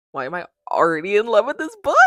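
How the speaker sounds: real fangirl outburst; excited gushing. Real, not acted. Gushes after reading the blurb.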